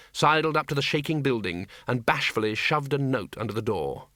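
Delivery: ordinary delivery, nothing marked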